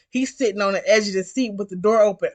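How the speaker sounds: ordinary talk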